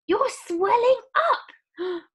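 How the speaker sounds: very high, loud, slow horrified scream. Acted. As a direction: scream in horror. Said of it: "screamed Missus Beauregard".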